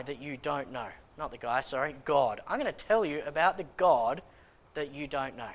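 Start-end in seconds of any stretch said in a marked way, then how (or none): none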